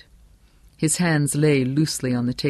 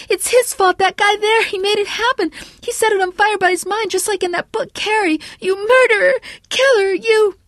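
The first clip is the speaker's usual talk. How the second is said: shrieking hysterical accusation; high, hysterical; 6 dB louder than usual. A narrator's reading. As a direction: accuse him at the top of your voice. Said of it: "began to talk in a high, hysterical voice".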